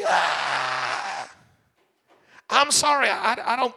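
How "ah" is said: mock exasperated shout. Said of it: "and I'm like".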